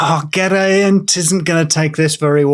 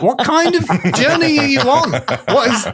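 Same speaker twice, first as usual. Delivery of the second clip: incredulous; 8 semitones higher than usual. Retold or imitated. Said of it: "to be like".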